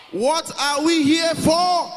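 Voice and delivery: very high, slow, drawn-out yell; full-force crowd chant; shouting. Shown as a demonstration. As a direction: bellow each word to the whole hall. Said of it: "shout it".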